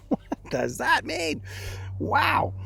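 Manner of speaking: high, drawn-out mock astonishment; amused bafflement. Dreamer waking up laughing, high-pitched baffled amazement. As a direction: squeak it in amused disbelief. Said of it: "I wake up laughing".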